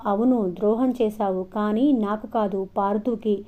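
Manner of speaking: ordinary delivery, nothing marked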